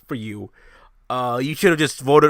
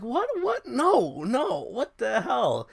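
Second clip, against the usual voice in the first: retold disbelief; 8 semitones higher, 6 dB quieter, slower than usual. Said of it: "And I'm like".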